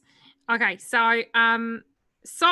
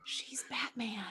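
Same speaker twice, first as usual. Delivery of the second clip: breathy awed whisper; 2 semitones higher, 12 dB quieter, slower than usual. Real, not acted. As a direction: whisper it in awe. Whispered awe at table.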